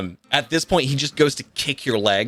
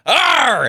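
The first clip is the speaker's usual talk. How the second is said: high, loud mock roar; battle roar; 10 semitones higher, 4 dB louder than usual. The wrestler roaring as he charges at the hero. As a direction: let out a hammed-up charging roar. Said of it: "And he goes".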